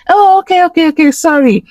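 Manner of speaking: high-pitched retold apology, settling down; high, quick string of okays; mock apology. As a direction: imitate a flustered high-voiced sorry. Retold reaction to being corrected.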